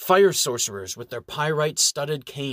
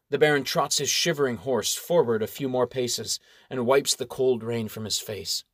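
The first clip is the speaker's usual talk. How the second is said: ordinary delivery, nothing marked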